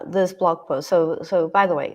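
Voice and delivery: ordinary talk, nothing marked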